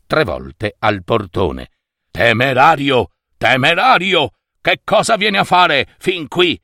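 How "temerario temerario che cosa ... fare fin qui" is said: challenging wizard, rising higher; indignant wizard. A narrator's reading. Wizard inside the palace challenges the reckless visitor, indignant. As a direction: voice an affronted sorcerer challenging an intruder. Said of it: "si sentì il mago da dentro".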